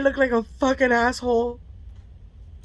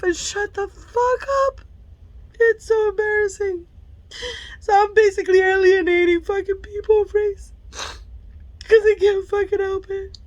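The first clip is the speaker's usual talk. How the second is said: real tears, voice pitched up; tearful; 7 semitones higher than usual. Real, not acted. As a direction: talk through the tears. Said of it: "I'm tearing up".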